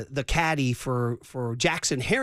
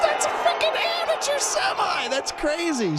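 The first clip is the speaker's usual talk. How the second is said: high, amazed burst of disbelief; thrilled. Real, not acted. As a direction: let it fly, still amazed. Marvelling at the amateur semifinal.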